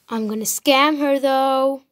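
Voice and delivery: scheming villain aside; sneering panto villain. Acted. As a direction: gloat to the audience like a villain. Aside about scamming.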